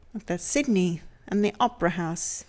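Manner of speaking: ordinary delivery, nothing marked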